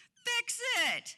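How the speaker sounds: high, loud demanding yell; childish shout. Retold or imitated. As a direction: shout it like a stubborn kid.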